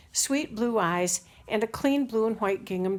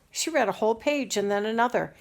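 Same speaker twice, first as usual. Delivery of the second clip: ordinary delivery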